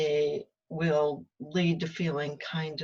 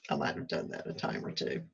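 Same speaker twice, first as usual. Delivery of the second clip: whispered aside; 8 dB quieter than usual. Real, not acted. Wry aside.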